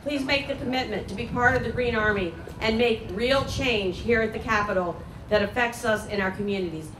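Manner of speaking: ordinary talk, nothing marked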